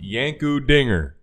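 ordinary talk, nothing marked

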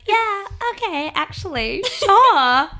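high-pitched mock acceptance of an offer; mock giving in. Woman replays agreeing to the cuddle offer, high mock giving in. Mocking her own past giving in.

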